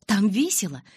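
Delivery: ordinary delivery, nothing marked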